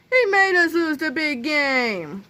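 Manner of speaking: high, slow drawn-out team complaint; football team crying out. A narrator's reading. Football team blaming Melvin, crying out. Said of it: "cried the entire football team simultaneously".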